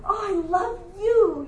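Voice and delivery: high, melting mom voice; gushing mom. Retold or imitated. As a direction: melt with love for your son. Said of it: "I'm just like".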